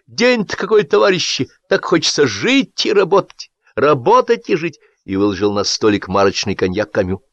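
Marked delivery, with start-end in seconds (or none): 0.1-4.8 high, sing-song gushing enthusiast; a fawning hack beaming at his comrades; high-pitched over-eager voice; gushing Sapfirov. A narrator's reading.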